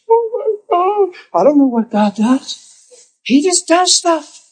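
high, slow stunned "oh my God"; overwhelmed awe. Retold or imitated. Pastor imitating an overwhelmed woman during prayer, high and slow. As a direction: let the awe stretch the words out.

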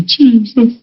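breathy swooning "Jesus"; gushing church lady voice; mock worshipper. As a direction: imitate an over-the-top worshipper. Mocking worship ladies.

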